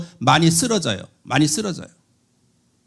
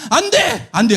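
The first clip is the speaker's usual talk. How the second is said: 단호한 금지; 2 semitones higher than usual. Retold or imitated. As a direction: forbid it firmly. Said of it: "하는 거야". (단호하게 금지하는 외침)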